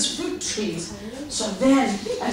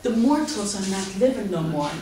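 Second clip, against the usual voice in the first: ordinary delivery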